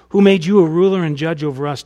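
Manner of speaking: a defiant shout back at Moses; loud challenge. Acted. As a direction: hurl it loud and scornful, stressing you. Quote reread with hostile force.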